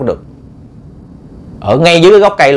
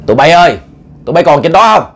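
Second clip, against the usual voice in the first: raised voice calling to people above; 3 semitones higher, 3 dB louder, faster than usual. Acted. Old leader calls up to men in the tree, calling out. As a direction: call out to the group up high.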